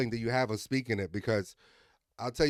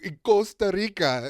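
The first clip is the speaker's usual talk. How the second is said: higher, louder mock-Italian lilt; Italian accent; 7 semitones higher, 5 dB louder, slower than usual. Teasing his friend about Costa Rica, exaggerated Italian accent. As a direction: imitate an Italian saying the place name. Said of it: "certain words in the Italian accent".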